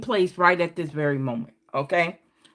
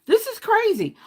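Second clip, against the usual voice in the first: much higher, wide-eyed exclaim; excited; 11 semitones higher than usual. Real, not acted. Tarot reader amazed at the repeat card, excitedly. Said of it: "I get excited".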